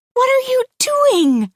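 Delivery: high, loud, slow terrified cry; mother clutching her baby cries out in terror; frightened cry. A narrator's reading. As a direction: cry out in panic at the attacker. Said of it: "she cries".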